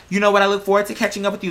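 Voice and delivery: ordinary talk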